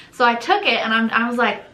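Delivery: ordinary talk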